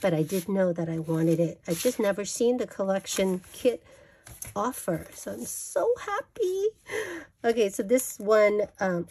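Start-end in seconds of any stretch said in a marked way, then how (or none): 5.4-6.7 delighted squeal. Real, not acted. Delighted to find kit.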